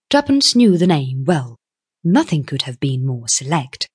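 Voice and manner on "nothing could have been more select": usual speaking voice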